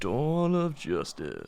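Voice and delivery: very slow, dramatic trailer announcer; movie trailer voice. Pretend. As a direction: stretch each word like an epic trailer. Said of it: "Hang on".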